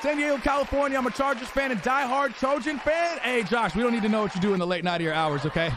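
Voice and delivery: ordinary talk, nothing marked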